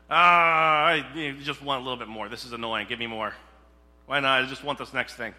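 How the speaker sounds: loud whiny complaining; mock grumbling self. Preacher imitating his own grumbling, loud whiny complaint. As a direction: whine like a greedy child. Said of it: "the grumbling aspect".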